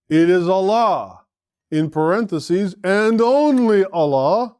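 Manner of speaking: slow, high and loud on "only Allah"; emphatic stress on Allah. Shown as a demonstration. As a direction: drive home "Allah" each time, slowly. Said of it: "the emphasis is on the fa'il".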